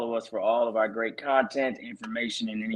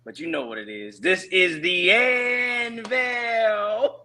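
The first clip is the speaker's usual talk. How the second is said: wrestling announcer intro; 5 semitones higher, slower than usual. Pretend. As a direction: bellow the names like ring intros. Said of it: "give us your best W W E intro".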